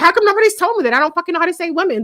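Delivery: high-pitched incredulous wail in words; worked-up retold complaint; exasperated complaint. Retells her exasperation.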